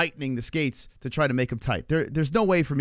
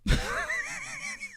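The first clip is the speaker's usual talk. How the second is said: high laugh; 7 dB quieter than usual. Real, not acted. Laughing at own fall.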